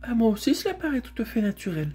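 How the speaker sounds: high-voiced mare; talking mare. Mare backing up Bré, in a high voice. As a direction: pitch it up for the mare.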